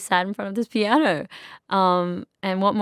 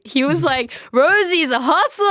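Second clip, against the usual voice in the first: excited; 10 semitones higher, 7 dB louder than usual. Retold or imitated. Retelling Bruno praising her.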